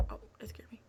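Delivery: normal talking voice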